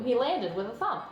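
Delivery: usual speaking voice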